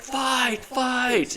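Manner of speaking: high shouted command; shouting. Retold or imitated. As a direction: bark it at the actors. Director shouting at actors.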